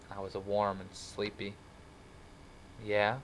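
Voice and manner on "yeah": ordinary delivery, nothing marked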